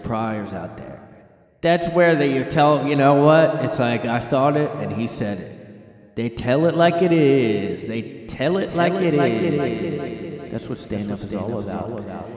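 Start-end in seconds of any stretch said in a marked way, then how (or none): 1.6-12.3 loud, high, drawn-out rallying speech; big, echoing hammed-up tribute; mock-grandiose hype. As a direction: preach the truth of stand-up like a stirring anthem. Mock hype speech about stand-up truth.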